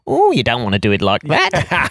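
put-on voice of a meddling know-all; comic busybody character voice; Harry Enfield. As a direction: butt in with comic unsolicited advice. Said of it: "He used to have this character that would say".

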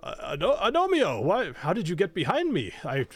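a naive knight caught off guard; surprised knight. Acted. GM plays startled Roland.

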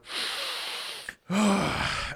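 long, exaggerated sniff and breath out; imitated marker sniff. Faking a marker addiction for the interviewers, long mock sniff. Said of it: "take the cap off and just go".

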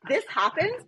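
usual speaking voice

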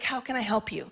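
soft, low, kind offer; a quiet, caring voice; gentle God. Pretend.

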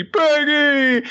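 high, drawn-out mock wail; hammed-up crying. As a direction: wail over a fallen comrade. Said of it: "the bear is crying over Private Peggy".

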